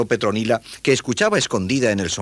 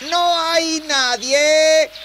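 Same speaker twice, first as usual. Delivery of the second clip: very high, drawn-out holler; loud, long-held shout; 7 dB louder, much slower than usual. Acted. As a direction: call far off, stretching each word. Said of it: "gritó".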